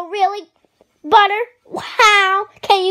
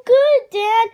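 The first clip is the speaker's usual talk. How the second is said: normal talking voice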